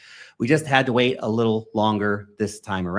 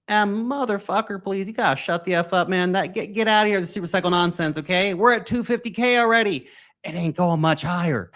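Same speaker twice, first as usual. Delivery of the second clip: mock exasperated rant; 6 semitones higher than usual. Mocking super-cycle believers.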